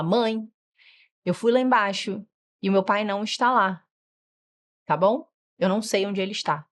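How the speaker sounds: put-on calm, measured child explaining; even and unhurried, no fuss; speaking calmly. Shown as a demonstration. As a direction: model a polite, composed report to mum. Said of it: "Eles aprendem a falar com calma".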